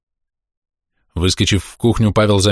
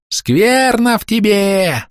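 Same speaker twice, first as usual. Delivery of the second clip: very high, drawn-out woman's screech; 13 semitones higher, much slower than usual. A narrator's reading. Woman behind a bush denounces Pavel, screeching. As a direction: screech it high and long like an accuser. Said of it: "заверещала женщина вороньим пугалом".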